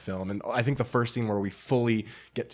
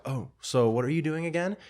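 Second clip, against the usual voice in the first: higher-pitched mock-innocent question; suddenly sober confrontation; 6 semitones higher than usual. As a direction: act out a sober, pointed question. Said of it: "is fully sober".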